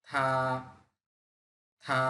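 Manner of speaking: থা held long and level; থা drawn out in a flat first tone; Mandarin first tone. Shown as a demonstration. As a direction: stretch থা on one flat pitch. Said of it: "একটু টেনে বলতে হবে".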